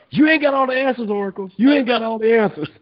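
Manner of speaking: high-pitched, interrupting outburst. Retold or imitated. Rapper interrupting the Oracle, indignant high-pitched impression. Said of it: "that's when [name] busts in and interrupts".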